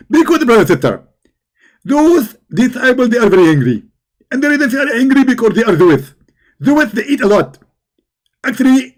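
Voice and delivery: high, loud preachy mimic; Zakir Naik. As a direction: lecture like an excited preacher. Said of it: "If we ask Zakir Naik".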